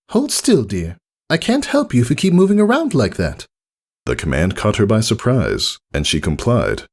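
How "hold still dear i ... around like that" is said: high, elegant and polite; gracious welcome; refined lady unicorn. Acted. Rarity greeting Rainbow Dash at her door, refined politeness.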